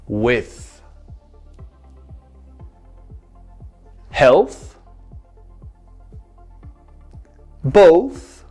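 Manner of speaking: each word slow and clearly separated; slow exaggerated speech modeling. Shown as a demonstration. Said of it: "I want you to imitate me".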